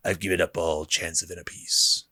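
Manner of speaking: a hint of a brooding spymaster; lightly voiced Luthen. Fan reciting Luthen's monologue, soft and grave. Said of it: "It's the Luthen monologue".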